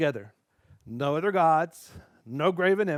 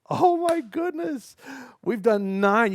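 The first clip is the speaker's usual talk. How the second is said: much higher and louder delight; excited; 8 semitones higher, 7 dB louder, slower than usual. Real, not acted. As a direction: celebrate with amazement. Celebrating finishing nine.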